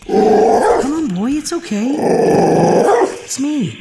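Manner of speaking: high, tense coaxing; nervous coaxing. Acted. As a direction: soothe the dog while scared. Coaxing a rabid dog.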